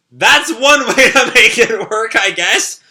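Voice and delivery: slow, loud, laughing through the taunt; mocking laugh. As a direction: laugh at the crying loser. Character mocks crying opponent.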